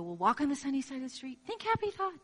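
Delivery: a mocking, sugary lilt; hammed-up sunny optimism; mock cheerful. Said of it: "Pollyanna thinking".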